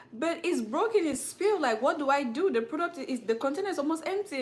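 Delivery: high-pitched pleading complaint, imitated; retold protest.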